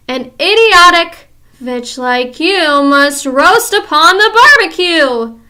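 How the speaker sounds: slow, loud, high menacing chant; terrible recital. Acted. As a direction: chant it with cruel glee. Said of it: "her terrible recital".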